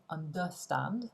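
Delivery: understand with the stress wrongly on DER; deliberately mis-stressed unDERstand; wrong stress on second syllable. Shown as a demonstration. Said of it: "Il n'est pas non plus sur la deuxième syllabe".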